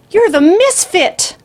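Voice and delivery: put-on high, loud, slow old woman's voice; an old lady gasping out a recognition; startled old lady. A narrator's reading. Grandmother recognizes the killer.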